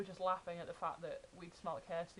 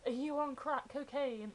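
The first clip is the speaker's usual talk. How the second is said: higher, slow mock rant quote; quoting a video rant; 7 semitones higher, 7 dB louder than usual. Friend [name] quotes Josh's rant at Dan, mock rant. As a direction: parrot the video line back, drawn out. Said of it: "Like, quoting that video".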